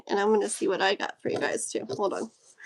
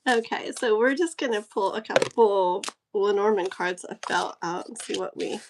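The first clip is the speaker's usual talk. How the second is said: ordinary delivery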